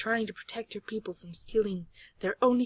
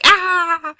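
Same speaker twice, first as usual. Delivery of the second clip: loud, high cry of fright; startled scream; 9 semitones higher, 15 dB louder than usual. Acted. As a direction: let out a big startled scream. Another scream in the story.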